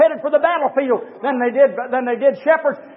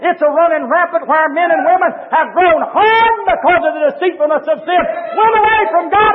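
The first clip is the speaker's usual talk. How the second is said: raised, ringing sermon climax; fiery preaching; 7 semitones higher, 4 dB louder than usual. Acted. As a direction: build the sermon to a passionate peak. Preacher rising to full force.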